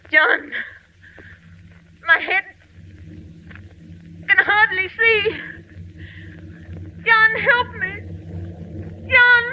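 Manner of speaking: terrified plea. Acted. Wife transforming, pleading with husband in terror. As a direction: beg for help as panic rises.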